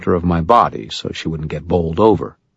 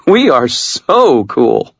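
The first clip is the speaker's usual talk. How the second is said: much higher, louder, nervously giddy woman; Murphy's voice; 7 semitones higher, 5 dB louder, much slower than usual. Acted. Murphy joking with Harry on a spook ride, high giddy voice. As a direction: squeal it like a thrilled kid on a ride.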